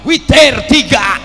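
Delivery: loud, blunt shouted announcement; imitated imam calling out curtly; imam's spoken reply. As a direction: bark the answer out to the rows. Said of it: "Dijawab sama imam".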